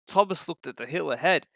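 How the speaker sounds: ordinary delivery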